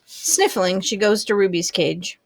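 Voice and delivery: ordinary delivery, nothing marked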